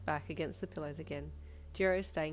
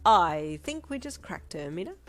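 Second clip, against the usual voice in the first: triumphant; 9 dB louder, slower than usual. Acted. Triumphant tease.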